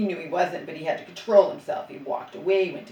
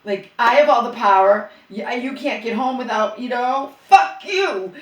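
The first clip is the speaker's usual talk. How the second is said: louder, mocking his power trip, rising to a yell; 2 semitones higher, 6 dB louder than usual. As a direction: mimic his gloating, then snap. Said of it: "no, it was".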